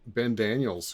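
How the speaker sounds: usual speaking voice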